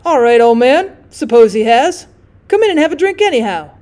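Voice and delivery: loud, breezy and joking; jocular. Acted. Drummer brushing off the young man, loud and jocular. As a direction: wave off the warning with a laugh. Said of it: "innocent and jocular, answered".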